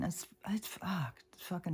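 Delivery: a real whispered fuck, overwhelmed; whispered curse. Real, not acted. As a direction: swear under your breath. Upset over crying dog.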